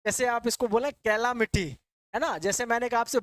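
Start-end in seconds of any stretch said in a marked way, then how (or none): none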